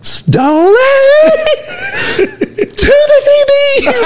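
long drawn-out high holler; imitated coach's scream. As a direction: scream it across the field, stretching the words. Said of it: "he would just scream and point".

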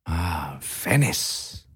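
soft breathy longing sigh; dreamy sigh. A narrator's reading. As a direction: sigh the name like a daydream. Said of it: "said Harry".

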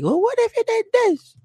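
very high falsetto mock woman; mocking movie woman. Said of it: "she's like".

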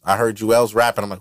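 ordinary talk

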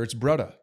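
"brudda" with a rolled-in D, no TH sound; Hawaiian brudda. Shown as a demonstration. Showing a friend the Hawaiian way to say brother, with a flapped D. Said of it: "it's not brother".

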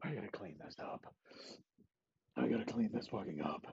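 very soft, low mutter; muttered to himself. Acted. Elway mutters quietly after shooting Pat. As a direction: say it under your breath.

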